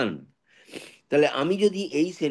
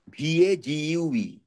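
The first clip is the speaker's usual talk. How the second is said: ordinary delivery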